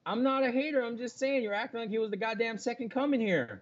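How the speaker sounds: high-pitched, indignant self-defense; exasperated protest. Real, not acted. Protesting being called a hater.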